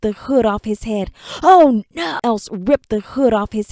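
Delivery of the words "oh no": sudden high, loud cry of alarm; frightened cry. Acted.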